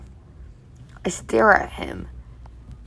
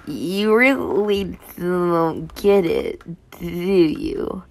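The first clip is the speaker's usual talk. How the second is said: slow, mocking male voice; taunting boyfriend voice; slower than usual. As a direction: drag it out, taunting her. Toby taunts narrator.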